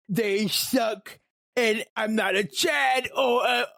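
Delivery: a lightly voiced whiny incel, rising to a mock shout; mock incel rant. Mocking incels he hates, whiny voice. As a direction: mimic a bitter online guy complaining. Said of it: "I hate you all that talk like that".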